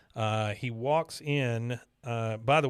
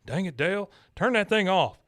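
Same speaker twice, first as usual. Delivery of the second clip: Hank impression; 7 semitones higher, 5 dB louder than usual. Podcaster retelling the cartoon, Hank scolding Dale loudly. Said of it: "Hank says".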